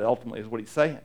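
ordinary delivery

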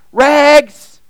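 loud, high street call. Acted. Ragman calls down the city alley, ringing loud call. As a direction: belt it out like a peddler hawking his wares. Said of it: "calling out in a clear tenor voice".

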